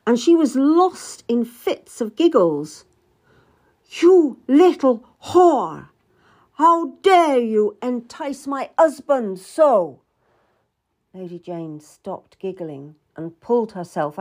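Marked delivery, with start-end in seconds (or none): 4.0-10.0 high, loud, slow fury; slow, high, indignant outburst; outraged queen. A narrator's reading. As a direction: lash out at a rival, stretching each word. Queen catches husband with Lady Jane.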